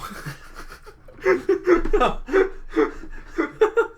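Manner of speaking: high shrieking laughter that breaks; howling laugh. Real, not acted. Laughing hard at the kid's reply to her birthday. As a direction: laugh till you nearly cry. Funniest thing she's heard.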